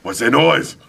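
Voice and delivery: high, loud worried question; fretting about a noise; nervous son. Retold or imitated. Nervous flier asks dad about noise, high worried. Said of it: "He goes".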